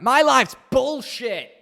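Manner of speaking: high, loud, drawn-out mock outrage; mock tantrum. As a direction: whine it loudly like a spoiled meltdown. Own overreaction to internet outage.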